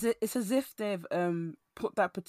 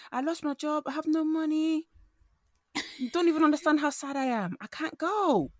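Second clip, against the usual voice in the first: high whiny mock sob; fake crying; 8 semitones higher, 4 dB louder than usual. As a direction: put on a hammed-up cry for money. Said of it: "the way I'm gonna cry to".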